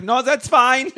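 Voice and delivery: very high put-on German accent; German posing as British. Spy turning down the waitress, high German-accented voice. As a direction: squeak a refusal in a fake German accent. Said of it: "talks with a British accent or a German accent".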